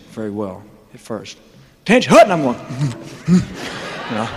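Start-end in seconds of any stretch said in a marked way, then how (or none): 1.8-2.3 much higher, louder mock shout; drill sergeant bark. Army drill call.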